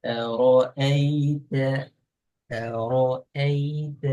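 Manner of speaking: slow أرأيت, each syllable drawn out; clear vowel sound. Shown as a demonstration. Teacher showing which sound to bring out, slow careful pronunciation. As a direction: say أرأيت slowly with a clear open "a". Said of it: "sound எடுக்கணும்".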